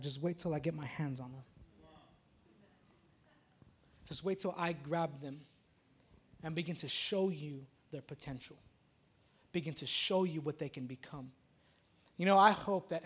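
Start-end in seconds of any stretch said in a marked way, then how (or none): 0.0-11.3 softer, lower promise; gently, as God reassuring; tender God voice. Retold or imitated.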